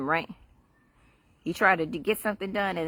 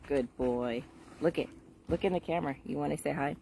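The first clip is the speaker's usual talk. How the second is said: soft coaxing; 3 semitones lower, 8 dB quieter than usual. Real, not acted. Coaxing the dog on camera.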